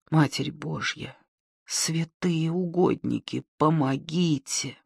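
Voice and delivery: тихая медленная испуганная молитва; прошептать мольбу в ужасе. Acted. Маня молится в страхе. (тихо молится)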